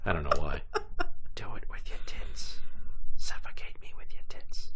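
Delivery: breathy seductive whisper; unvoiced, husky whisper. Whispered joke.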